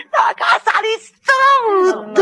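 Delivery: high, slow, tearful wail; sobbing over the ruined house; crying lament. Acted. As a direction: let the words break into weeping. Mother lamenting her sick husband.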